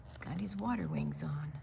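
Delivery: whispered aside. Real, not acted. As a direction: lean in and whisper it.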